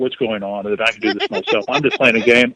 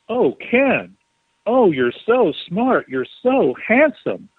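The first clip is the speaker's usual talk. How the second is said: higher, slow, syrupy praise; gushing flattery; 6 semitones higher, slower than usual. Pretend. Imagined spirit flattering Ken, gushing sweet higher voice. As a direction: lay on the sweet admiration, drawn out. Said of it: "if she had said it like this".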